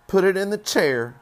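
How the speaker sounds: high, loud household order; bossy mother. A narrator's reading. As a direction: call out the instruction as mother. Mother orders the carton put down.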